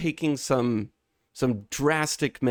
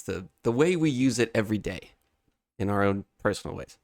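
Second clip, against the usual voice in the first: usual speaking voice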